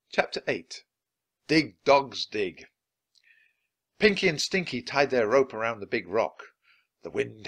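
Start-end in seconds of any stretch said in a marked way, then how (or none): none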